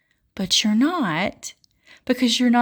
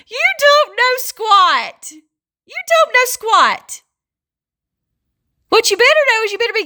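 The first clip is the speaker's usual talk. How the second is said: scolding; 9 semitones higher, 4 dB louder than usual. Real, not acted. Preacher rebuking chest-beating know-it-alls, high insistent scolding. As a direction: send it up high and lean on every word.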